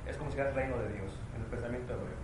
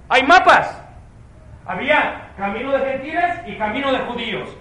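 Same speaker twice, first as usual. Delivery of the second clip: loud, high, forceful preaching; żarliwe kazanie; 11 semitones higher, 16 dB louder than usual. Real, not acted. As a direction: drive each phrase home to the congregation.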